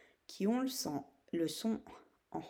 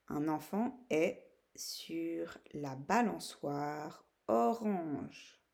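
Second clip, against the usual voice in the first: slow, word by word with pauses; dictation; slower than usual. Shown as a demonstration. Teacher giving pupils a sentence to write, dictation. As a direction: dictate it slowly, stretching the words. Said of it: "t'exercer à écrire la phrase".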